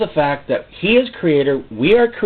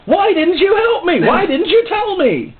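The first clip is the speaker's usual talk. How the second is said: much higher, slow whine; whiny complaint; 4 dB louder, slower than usual. Retold or imitated. Preacher retelling his own complaint to God, high and slow. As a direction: plead like a hurt child. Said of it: "I said to him".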